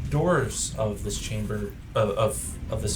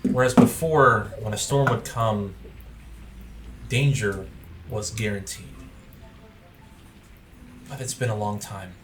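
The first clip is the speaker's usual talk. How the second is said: story narration; 4 dB quieter than usual. A narrator's reading. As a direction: set the scene softly over music. GM narrates the moody scene.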